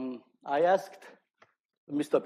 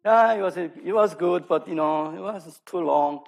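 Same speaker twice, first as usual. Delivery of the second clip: higher and louder, grudging praise that turns to complaint; lukewarm audience complaint; 5 semitones higher, 6 dB louder than usual. Pretend. Lecturer imitating a bored audience member, higher and louder grudging complaint. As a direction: play a bored listener who politely says it dragged. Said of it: "if I drag on and then".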